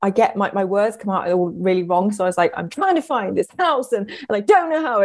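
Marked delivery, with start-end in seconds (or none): 2.6-5.0 high, stabbing panicked retort; imitating a scared, accidental half-shout; stabby, shouting. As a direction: jab the words out in a raised pitch. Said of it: "stabby with my words".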